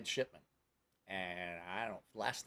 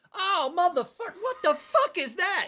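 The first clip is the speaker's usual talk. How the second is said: high, loud, hammed-up disbelief; shocked outburst; 10 dB louder than usual. Drunk man discovering his new tattoo, shocked outburst. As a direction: yell it in mock horror, drawing out the end.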